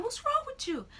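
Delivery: very high, panicked imitation; squeaky-high mock alarm; imitated alarm. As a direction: act out a boyfriend's startled question. Startled boyfriend quoted, almost wrecking.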